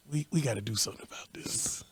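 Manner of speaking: hushed whisper of a plan; conspiratorial whisper. Retold or imitated. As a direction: whisper it like plotting. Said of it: "whispering conspiracy".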